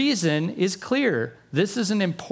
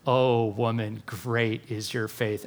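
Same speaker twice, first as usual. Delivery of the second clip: hushed and tender, a little slower; tender praise; 6 semitones lower, 3 dB quieter, slower than usual. Retold or imitated. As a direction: lower and softer, gently admiring. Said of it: "he goes".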